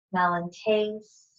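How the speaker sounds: calm, unhurried guidance with long pauses; low-key, measured meditation voice; guided meditation. Acted. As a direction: settle them gently into the present, phrase by phrase. Leads a grounding meditation.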